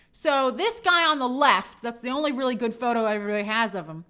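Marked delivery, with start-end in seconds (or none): none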